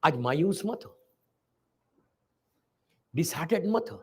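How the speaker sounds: normal talking voice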